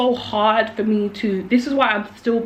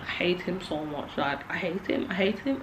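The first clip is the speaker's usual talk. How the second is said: much softer, bitter hatred under the breath; quiet seething; 3 semitones lower, 10 dB quieter than usual. Real, not acted. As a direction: hold the hate low and quiet. Seething about abuser on camera.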